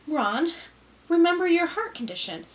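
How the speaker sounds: wife reining her husband in; warning wife. A narrator's reading. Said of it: "what she had always said".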